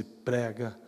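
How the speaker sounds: low, very soft, tender voice; sweet voice. Retold or imitated. Preacher retells the Spirit urging him to preach, sweet and hushed. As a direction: let it sink to a gentle hush. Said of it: "aquela mesma doce voz disse".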